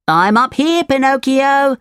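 high voice calling from above; Jiminy Cricket, calling. Acted. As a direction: call down as a little cricket.

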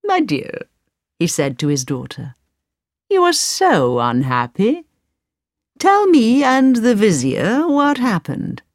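gentle Sultan. Acted. Sultan speaks to daughter.

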